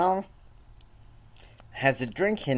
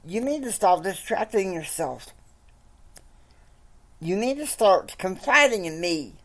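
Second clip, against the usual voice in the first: higher pleading voice of Madison; pleading wife; 11 semitones higher, 4 dB louder than usual. Acted. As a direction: nag him to talk, voice pitched up. Madison scolds Kyle.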